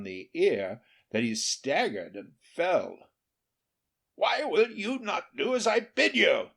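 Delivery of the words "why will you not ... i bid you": raised, louder angry peasant; angry demand. A narrator's reading. Charcoal burner angrily scolds his stubborn guest. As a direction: scold the guest who won't obey. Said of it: "he asked angrily".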